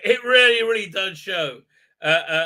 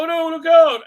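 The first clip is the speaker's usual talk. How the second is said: high, loud hammed-up cry of warning; mock alarm; 10 semitones higher, 10 dB louder than usual. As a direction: yell the warning like a panicked B-movie hero. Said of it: "you go".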